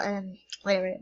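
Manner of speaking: usual speaking voice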